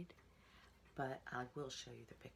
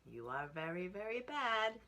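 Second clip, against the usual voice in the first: higher teasing voice; brother pig; 6 semitones higher, 6 dB louder than usual. A narrator's reading. Brother scolding Piggy, higher teasing voice. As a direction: taunt him a bit. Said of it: "said the brother".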